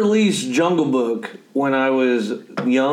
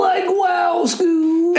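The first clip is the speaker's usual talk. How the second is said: Shaggy voice; 12 semitones higher, much slower than usual. Retold or imitated. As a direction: screech it like Shaggy. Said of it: "I'm trying to get Shaggy".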